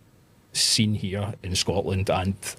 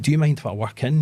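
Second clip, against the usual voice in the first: polite ask; 6 semitones higher, 5 dB louder than usual. Retold or imitated. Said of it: "I went".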